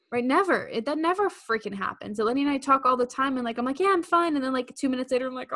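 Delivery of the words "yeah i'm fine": high, loud, breezy brush-off; quick mock-chipper cover, pitched up; falsely cheerful. Telling Eleni all is well, falsely cheerful. Pretending to be fine to a friend.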